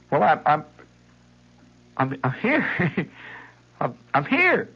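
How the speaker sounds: high, halting, helpless insistence; stammering little boy. Retold or imitated. Boy keeps telling the mother he is here. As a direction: get stuck repeating it.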